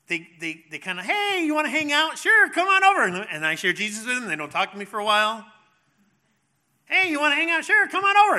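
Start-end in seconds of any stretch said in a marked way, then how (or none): 1.0-3.0 high, loud sing-song voice; hammed-up cheery invite, pitched way up; mock friendly invitation. Preacher mimicking relatives asking to hang out, mock cheery. 6.9-8.4 high, loud sing-song voice; hammed-up cheery invite, pitched way up; mock friendly invitation. Preacher mimicking relatives asking to hang out, mock cheery.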